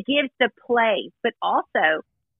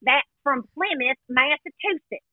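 light mock Southern accent, slow and higher; 4 semitones higher than usual. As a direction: send up her fake Southern belle accent. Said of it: "talking like this".

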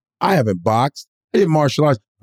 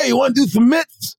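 high, eager mock call; imitated coach; 10 semitones higher than usual. Boxing coach inviting a newcomer, imitated call. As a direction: imitate a gym guy calling you over.

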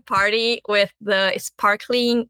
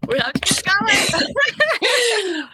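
laughing; 11 semitones higher, slower than usual. Real, not acted. Laughing through suspense pause.

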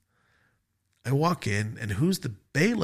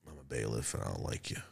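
much lower, quieter, grim bailiff voice; cold stern bailiff; 12 semitones lower, 16 dB quieter, faster than usual. Retold or imitated. The bailiff, suddenly turned against the defendant, coldly. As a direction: drop low and cold like an official. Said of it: "He's just like".

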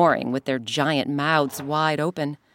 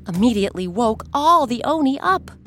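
plain reading voice

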